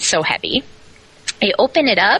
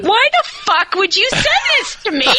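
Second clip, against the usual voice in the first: high, outraged mock yell, much higher; yelling at the box. Unpacking the ex-boss's box, yelling high and outraged. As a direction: rant at the box like you've lost it. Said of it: "actually saying out loud".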